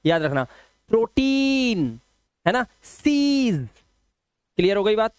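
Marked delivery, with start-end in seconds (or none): 0.9-2.7 प्रोटीन high and slow, "pro-teeen"; EI as long "ee". Shown as a demonstration. Teacher giving another EI example, raised, held "ee" in प्रोटीन. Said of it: "वो ई से करना है". 2.8-3.7 सीज high and slow, "seeez"; EI as long "ee". Shown as a demonstration. Teacher giving learners an example, raised drawn-out "ee" in सीज. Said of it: "वो ई से करना है".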